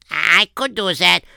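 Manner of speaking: lightly put-on German accent on an obliging boy. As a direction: try a German accent as the eager son. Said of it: "should do a German accent too".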